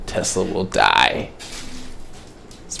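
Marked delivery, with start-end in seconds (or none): 0.1-1.3 ominous quote. Retold or imitated. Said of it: "This man just walked in and said".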